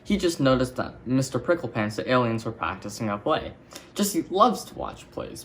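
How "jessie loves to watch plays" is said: thinking to himself. Acted. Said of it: "Buzz thought".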